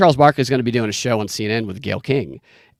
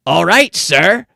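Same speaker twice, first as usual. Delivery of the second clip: higher, louder, slow sleazy voice; sleazy pawn shop guy; 5 semitones higher, 5 dB louder, much slower than usual. Retold or imitated. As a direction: ham up a creepy salesman, pitched up. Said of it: "And the guy goes".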